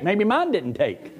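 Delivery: ordinary delivery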